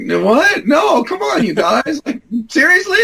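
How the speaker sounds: protesting. Retold or imitated. Young applicant pleading with mocking mentors, high indignant shout. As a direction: yell back in disbelief.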